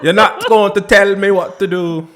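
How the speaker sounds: loud, high Irish Jamaican outburst; defiant shout in an Irish Jamaican accent. Acted. Fed up with being told what to do, defiant Irish Jamaican accent. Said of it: "my Irish Jamaican accent".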